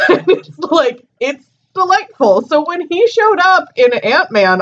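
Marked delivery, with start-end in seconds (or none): none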